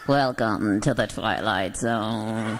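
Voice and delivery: low, slow Twilight Zone narrator; a hammed-up sci-fi host voice; spooky announcer. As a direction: intone it like an eerie TV host.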